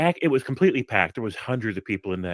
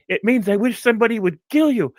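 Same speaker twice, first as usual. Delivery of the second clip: much higher, louder imitation of the boss; laughing boss's reply; 12 semitones higher, 7 dB louder than usual. At the mall pizza place, the boss explains the insult, much higher and louder. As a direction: play the boss, pitched way up and loud.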